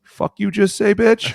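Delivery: hammed-up squad outrage at the insult; outraged shriek. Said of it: "everybody was like".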